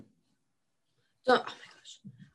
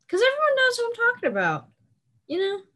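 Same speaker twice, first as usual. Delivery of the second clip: high excited; 13 semitones higher, faster than usual. Real, not acted. Excited rant.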